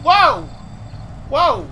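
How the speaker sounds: short, loud bursts of alarm; startled whoas. Real, not acted. Player backing off from the creature, startled shouts. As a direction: recoil with each startled "whoa".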